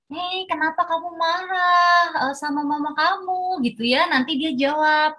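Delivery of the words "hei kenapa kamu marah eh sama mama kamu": put-on gently asking a child. Shown as a demonstration. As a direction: ask it like a mother calming her child. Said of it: "Misalnya kita ngomong".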